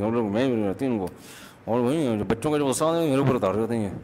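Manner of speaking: low, soft grumbling under the breath as the mother; mother's muttered complaint. Retold or imitated. Preacher imitating his mother muttering quietly about the father. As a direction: imitate mom muttering so dad won't hear. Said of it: "آرام آرام سے ابا کو بولو".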